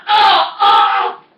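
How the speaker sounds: high, loud mock scream; short panicked yells, acted out; reenacted screams. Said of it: "that I'm screaming".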